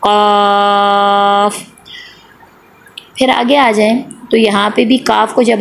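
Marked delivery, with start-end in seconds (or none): 0.0-1.6 क़ाफ़ with the long aa held very long; elongated madd. Shown as a demonstration. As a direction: stretch the vowel of क़ाफ़ on one steady note, then close on f. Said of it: "तो इसको हम ज़्यादा लंबा करेंगे".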